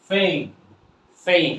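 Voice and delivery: "ফেম" with long A and silent final E; last vowel dropped. Shown as a demonstration. Teacher models for learners, "ফেম" without the last vowel. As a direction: end "ফেম" firmly on M, no vowel after. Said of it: "লাস্টের ভাওয়েলটা বাদ দিয়ে দেব".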